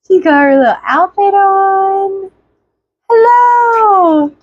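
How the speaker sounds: high, drawn-out hello. Real, not acted. Cooing at baby.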